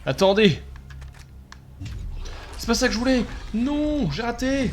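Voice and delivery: panicked wail. Real, not acted. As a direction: cry out in rising panic. Panique, tir raté. (cri paniqué)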